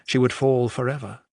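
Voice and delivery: ordinary delivery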